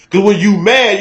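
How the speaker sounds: ordinary delivery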